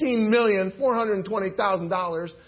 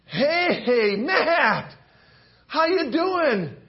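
cheerful greeting; 6 semitones higher, 4 dB louder, much slower than usual. Acted. Servant cheerfully greets fellow.

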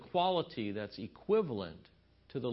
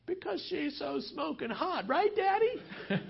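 put-on much higher, slow little girl voice; six-year-old daughter; slower than usual. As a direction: play the innocent six-year-old chiming in. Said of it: "six-year-old, she goes".

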